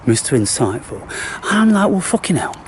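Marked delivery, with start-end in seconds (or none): 0.1-1.0 sarcastic mock. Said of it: "as if say".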